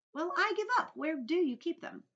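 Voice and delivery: put-on high, loud child giving up; exasperated boy. A narrator's reading. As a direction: throw up the question in defeat. Boy gives up guessing.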